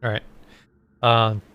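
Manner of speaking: ordinary delivery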